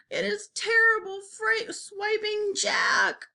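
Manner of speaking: high, drawn-out wailing; terrified bellowing. A narrator's reading. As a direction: keep up a high cry of panic, each word stretched. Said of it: "bellowed the flesh lump eater".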